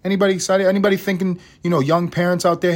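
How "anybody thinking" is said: ordinary talk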